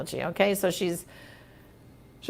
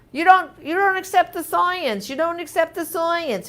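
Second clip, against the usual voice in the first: higher, heated harping; mock yelling at the TV; 8 semitones higher than usual. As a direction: hammer it home like arguing with the TV. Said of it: "I was yelling at my T V".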